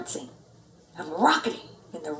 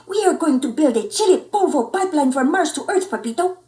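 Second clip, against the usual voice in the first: tiny chihuahua voice; 6 semitones higher, 5 dB louder than usual. Acted. Poquito Tito explaining.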